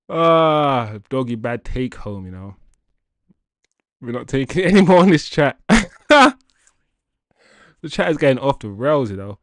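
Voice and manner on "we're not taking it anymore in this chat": laughing. Real, not acted. Chat jokes crack him up.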